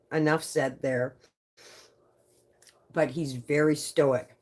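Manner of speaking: ordinary talk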